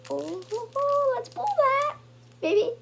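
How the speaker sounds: high, loud excited squeal; rising into a thrilled, high cheer. Real, not acted. Collector hyped over a card they hope to pull, excited.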